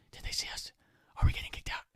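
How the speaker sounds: hushed, worried whisper from a hiding spot; hiding friend's anxious whisper. Retold or imitated. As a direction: whisper it so nobody hears. Friend hiding under chair.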